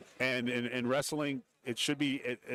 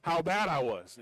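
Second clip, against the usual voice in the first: put-on boastful old-timer; 7 semitones higher, 3 dB louder than usual. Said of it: "He's like".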